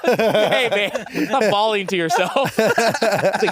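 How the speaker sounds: laughing through the words, loud; cracking up while talking. Retold or imitated. Laughing mock quote.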